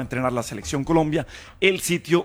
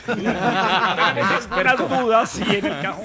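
joking through laughter, pitched up; laughing; 4 semitones higher than usual. Real, not acted. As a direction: talk while laughing at the joke. Joking about Pinzón statue.

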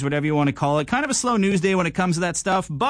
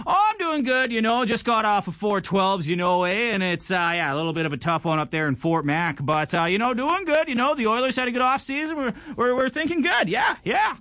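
much higher, hammed-up Alberta oil-patch guy accent, fast in spots; Alberta boy accent; 6 semitones higher than usual. Host impersonating an Alberta guy on a dating show, Alberta accent. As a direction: play a chatty Alberta boy back from Fort Mac, eh, in his accent. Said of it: "an Alberta Bud impression".

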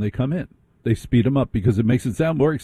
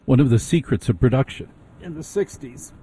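ordinary talk

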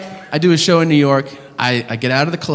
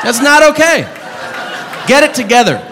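comic rant; 8 semitones higher than usual. Real, not acted. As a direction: yell at him to sort himself out. Ranting at toothless man.

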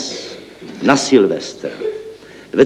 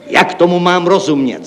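higher, raised exasperated question; exclaimed; 7 semitones higher, 3 dB louder than usual. A narrator's reading. Chief accountant confronting the building clerk, exclaiming. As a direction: demand an explanation out loud. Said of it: "zvolal hlavní účetní".

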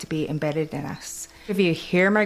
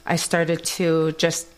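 ordinary delivery

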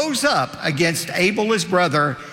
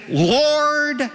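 pleading cry; 13 semitones higher than usual. Acted. Preacher voicing the condemned on judgment day, high pleading cry. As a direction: Lord cried out much higher, drawn out and falling.

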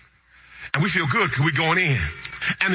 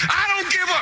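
excited shout; 13 semitones higher than usual. Real, not acted. Preacher exclaiming in story.

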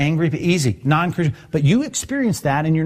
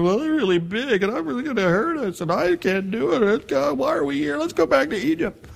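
higher, rising whine, growing shriller; whiny complaining; 9 semitones higher than usual.

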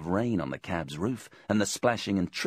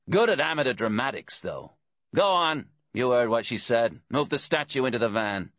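lightly voiced bent policeman, raised pitch; cheerfully bossy detective; 5 semitones higher, 5 dB louder than usual. A narrator's reading. Detective hurries captive to load the van, cheerfully bossy. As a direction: chat breezily, then order him on. Deeks jokes, then orders captive.

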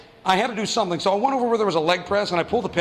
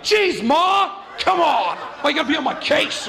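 put-on whiny teen yelling; 8 semitones higher than usual. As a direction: snap back at mom like a bratty twelve-year-old. Kid yelling at mom.

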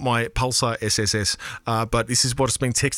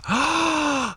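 sharp high mock gasp of awe; playful gasp. Real, not acted. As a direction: gasp as if scandalised. Reacting to trailer reversing text.

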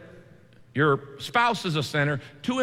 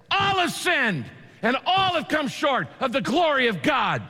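higher-pitched angry preaching shout; 8 semitones higher than usual. Shown as a demonstration. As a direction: hammer it at them in anger. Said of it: "I could shout it to you in anger".

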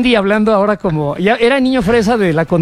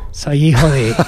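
mock Tabasco accent; 6 semitones lower, slower than usual. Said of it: "imitar el acento tabasqueño de su padre".

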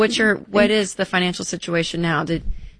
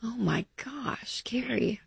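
whispered gasp of sympathy, higher; shocked whisper; 4 semitones higher, 10 dB quieter, slower than usual. Real, not acted. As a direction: breathe it out in shock for her. Hearing of sudden death.

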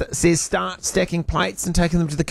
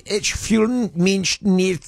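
German phrase; 5 semitones higher, much slower than usual. Retold or imitated. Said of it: "the Germans had a phrase".

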